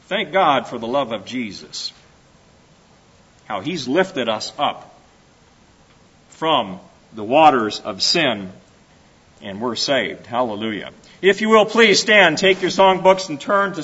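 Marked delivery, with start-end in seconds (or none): 0.1-10.9 low, softened gratitude; reverent thanks. Real, not acted. Preacher thanking God for being saved, hushed reverence. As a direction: drop down into quiet, heartfelt praise.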